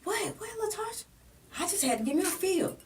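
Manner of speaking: high, put-on voice playing dumb; mock innocent reply. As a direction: imitate the man acting like he did nothing. Imitating his feigned innocence.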